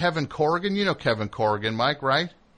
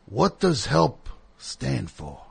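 slow host; much slower than usual. Retold or imitated. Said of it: "he comes out and he says".

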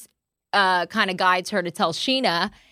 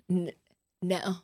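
mock serious; 5 semitones lower, 9 dB quieter than usual. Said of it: "so serious and just goes".

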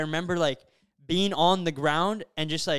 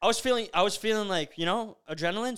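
ordinary talk

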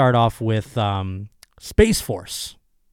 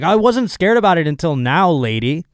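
indignant retort; 6 semitones higher, 5 dB louder than usual. Retold or imitated. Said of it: "I'm like".

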